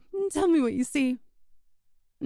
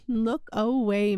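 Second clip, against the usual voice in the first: ordinary talk, nothing marked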